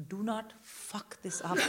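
put-on hushed, deliberate warning thought at her kid; will the kid silently not to slip; tense inner warning.

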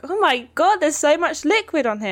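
high, loud gush of disbelief; retold astonishment. Retelling touching the soaked bed, astonished. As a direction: exclaim it as a mock-horrified discovery.

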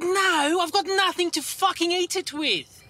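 high, indignant comeback; mock exasperated yell; mock outraged retort. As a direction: spit back the retort in disbelief. Angry retort to server.

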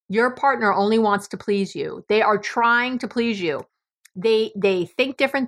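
ordinary delivery

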